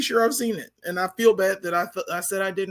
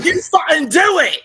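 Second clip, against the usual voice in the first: loud, higher, slow shouted dare; loud demand; 9 semitones higher, 10 dB louder, slower than usual. Real, not acted. Panelist shouting at Stu to do the accent. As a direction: yell at him to go for it.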